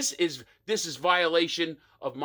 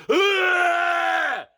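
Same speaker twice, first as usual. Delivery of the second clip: put-on loud, high drunken "Ah!" yell; 13 semitones higher, 7 dB louder than usual. Tailgating drunk inebriated at the show, loud high yell. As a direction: let out a wasted whoop. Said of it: "to the point where he's just a".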